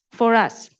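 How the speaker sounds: ordinary talk, nothing marked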